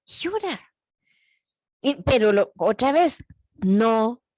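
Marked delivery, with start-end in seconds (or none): 0.1-0.7 tender permission. Real, not acted.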